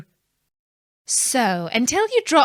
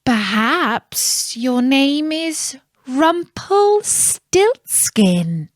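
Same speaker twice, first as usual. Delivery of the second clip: slow, higher, savouring the name; triumphant queen; 5 semitones higher, much slower than usual. A narrator's reading. The queen springing her trap, slow and pleased. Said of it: "she said".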